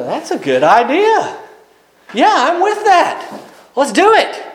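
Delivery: agreeing crowd. Acted. The people answer Elijah's contest proposal, agreeing eagerly. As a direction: voice a crowd going along with it.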